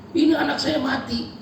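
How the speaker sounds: a hint of the weeping mother; grieving mother.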